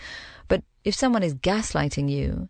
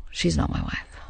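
hushed, breathy denial of the wife; drops to an unvoiced whisper on it; retold whisper. Man to salesman, brushing it off in a whisper.

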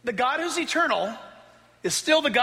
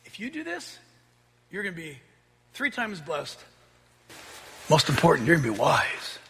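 soft and low, coaxing; Satan's hushed, tempting promise to Eve; sly tempter; 5 semitones lower, 5 dB quieter than usual. Retold or imitated. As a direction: murmur the promise like a serpent. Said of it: "That was one of Satan's lines to her".

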